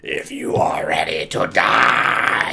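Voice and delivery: menacing demon threat, slow; loud demonic voice. Acted. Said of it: "in a loud demonic voice".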